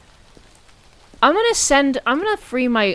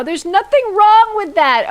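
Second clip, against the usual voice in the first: emphatic; 10 semitones higher, 9 dB louder than usual. Real, not acted. Defending her outfit.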